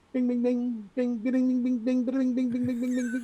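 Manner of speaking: high, rapid bing bing notification imitation; mimicking a phone pinging nonstop; phone pings. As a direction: chirp out a flood of phone alerts. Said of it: "my phone went bing".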